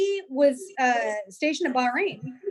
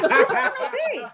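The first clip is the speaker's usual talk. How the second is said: higher, laughing through indignation; outraged but cracking up; laughing mock outrage; 11 semitones higher, 4 dB louder than usual. Recalling fight with husband, laughing indignation. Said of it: "I was mad".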